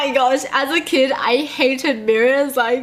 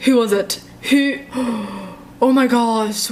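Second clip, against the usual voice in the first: usual speaking voice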